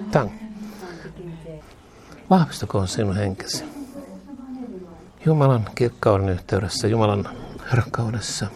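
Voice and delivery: hushed glossolalia blessing. Acted. Low prayer in tongues.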